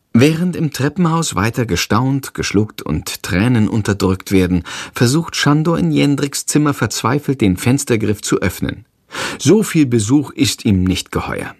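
ordinary delivery, nothing marked

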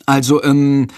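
hesitant stammer with pauses; nervous boy stalling the headmaster. A narrator's reading. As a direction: stall nervously, searching words. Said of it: "stottert Jendrik".

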